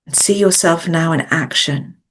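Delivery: measured coaching meditation voice with long pauses; guided visualisation. Acted. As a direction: guide them gently inward, phrase by phrase, leaving space to breathe.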